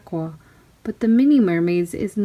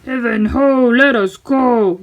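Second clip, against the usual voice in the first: louder, slowed pirate bellow; pirate yell; 3 semitones higher, 5 dB louder than usual. Acted. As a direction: belt it like a sea shanty. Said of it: "the pirate yelled".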